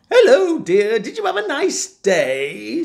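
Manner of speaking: high, sugary greeting; overly nice to her husband; sweet wife. Acted.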